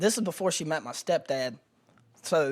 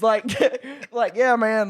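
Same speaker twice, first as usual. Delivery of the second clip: laughing; 6 semitones higher, 7 dB louder than usual. Real, not acted. Laughing about vibrators on floor.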